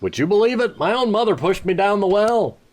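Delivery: high, lightly acted indignation; indignant young hero. Hero telling the apprentice, lightly acted indignation. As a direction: complain about mother with mock outrage. Voices hero's story reply.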